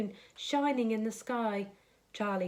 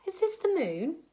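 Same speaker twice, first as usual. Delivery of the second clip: put-on little boy voice; 7 semitones higher than usual. Charlie asks the creature.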